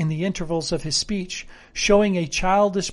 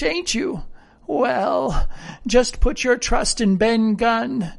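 higher-pitched rustic sailor storytelling; earnest old castaway, pitched up; garrulous castaway character; 5 semitones higher, 4 dB louder than usual. Acted. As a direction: ramble piously like a marooned sailor.

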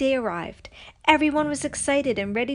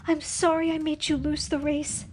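higher-pitched, understated sorry voice; muttered apology; 7 semitones higher than usual. A narrator's reading. Nakoma apologizing to her friend, muttering. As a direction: apologize without raising your voice. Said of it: "Nakoma muttered".

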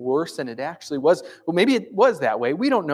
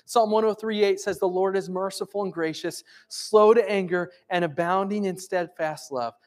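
scripture proclamation; 6 semitones higher, 5 dB louder than usual. Acted. Pastor proclaiming psalms to the congregation, preaching.

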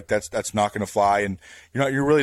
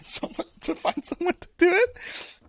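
squeaky laughing voice. Retold or imitated. Laughing retelling of escape.

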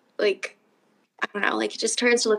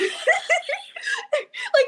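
startled laugh-scream. Retold or imitated. Startled scream while washing dishes.

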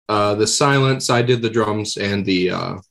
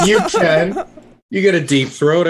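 laughing; 7 semitones higher, 4 dB louder than usual. Real, not acted. Teasing friend while laughing.

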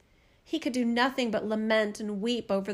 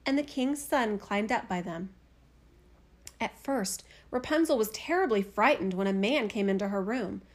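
ordinary delivery, nothing marked

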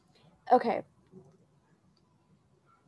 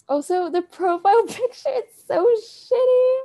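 louder and higher, laughing through the words; 11 semitones higher, 8 dB louder than usual. Real, not acted. Vlogger laughing at her own profile picture, loud and high. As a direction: giggle it out, embarrassed at the picture.

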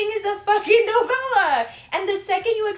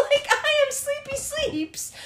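much higher, slowly, through giggles; laughing; 8 semitones higher, slower than usual. Real, not acted. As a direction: laugh out a cute sleepy line. Laughing cute phrase.

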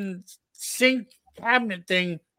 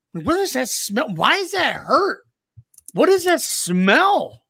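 high, rising bewilderment; retold mounting panic, pitched up; acted alarm; 5 semitones higher than usual. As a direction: stretch it out high in mock alarm. Retold alarm at smell and pain.

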